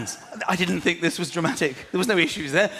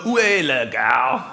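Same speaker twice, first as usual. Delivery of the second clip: bad English French; 5 semitones lower, 3 dB louder, slower than usual. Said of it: "we know".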